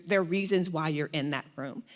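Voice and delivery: ordinary talk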